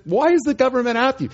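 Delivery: incredulous. Retold or imitated. Incredulous question to the stranded lady.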